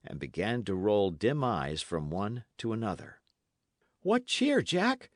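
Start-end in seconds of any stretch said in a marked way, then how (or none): 4.0-5.1 high, bright-spirited call to a friend; eager cheering. Acted. Dick to his reviving friend, eager and high-pitched. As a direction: cheer him up with a high, lively lilt.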